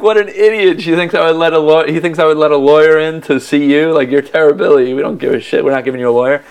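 high, loud villain scoffing at a fool; cracking-up mockery. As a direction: imitate a villain amused and mocking. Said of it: "like, cracking up".

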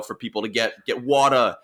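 usual speaking voice

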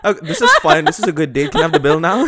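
laughing through the words; laughing, asking for the bill. Pretend. Acted awkward date, laughing.

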